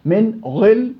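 "غل" said thick and heavy in the throat; heavy ghayn. Shown as a demonstration. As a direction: weigh down the غ in "غل". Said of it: "والله ثقيلة وحسيت عند الحلق".

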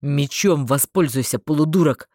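loud, low male voice; gruff exasperated order; angry command. A narrator's reading. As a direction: snap the order at a fool. Barked insult in battle.

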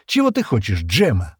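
usual speaking voice

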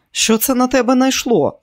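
high startled question; Kelly taken aback by a strange request; surprised. A narrator's reading. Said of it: "здивувалася Келі".

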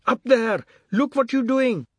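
high, loud indignant call; shout from below. A narrator's reading. Someone below to man upstairs, indignant shout. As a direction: shout up at the window. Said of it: "Someone shouted from below".

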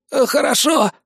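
high, startled agreement; frightened compliance. Acted. Frightened youth obeys command. (испуганное согласие высоким голосом)

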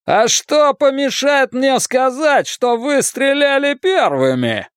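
high, slow, panicky retort; strained high-pitched defiance. A narrator's reading. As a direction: bluster with panic creeping in. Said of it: "Хейлс говорил высоким голосом".

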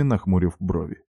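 usual speaking voice

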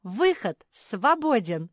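loud, high, drawn-out announcement; exultant shout; jubilant. A narrator's reading. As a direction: proclaim it in slow triumph. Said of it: "заликовала Мафи".

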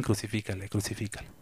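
almost under the breath; quiet recalled cry. Retold or imitated. Preacher softly recalls the crowd's cry, quiet murmur.

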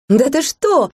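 a high joyful exclamation; squealing with happy surprise; joyful cry. A narrator's reading. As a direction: cry out in delighted disbelief. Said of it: "вскрикнула я радостно".

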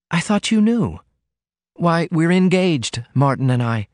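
put-on high girlish voice, slowing on the news; surprised Ruth. A narrator's reading. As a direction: announce the engagement wide-eyed. Ruth's voice held.

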